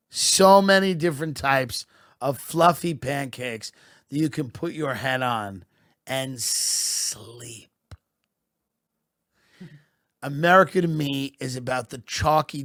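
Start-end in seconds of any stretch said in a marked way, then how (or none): none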